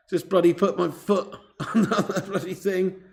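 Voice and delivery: words broken by laughter; laughing through pain. Real, not acted. Streamer who just hurt his foot, laughing as he explains. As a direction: explain it while laughing helplessly. Laughing after hurting himself.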